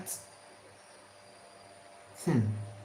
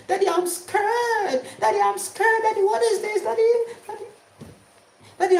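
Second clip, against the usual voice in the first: put-on much higher, whimpering child voice; scared child; 12 semitones higher than usual. Frightened child begging father during blackout, high and whimpering. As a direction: plead like a frightened little kid.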